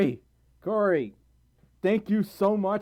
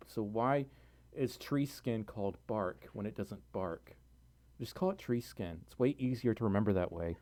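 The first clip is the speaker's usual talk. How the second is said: much softer, lightheaded musing; quiet rambling; 6 semitones lower, 9 dB quieter than usual. Real, not acted. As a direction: murmur it dazed and soft.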